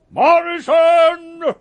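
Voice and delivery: loud, slow summons across the shop; calling out. Acted. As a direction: bellow the name, dragging it out. Boss calling across shop floor.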